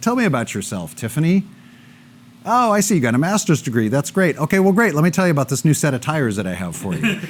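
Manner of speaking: a high-pitched, falsely chirpy sales pitch; mock the salesman who never listens; pushy salesperson. Salesman brushing off a client's degree, mock-chirpy and high. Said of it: "a salesperson that come in and they're like".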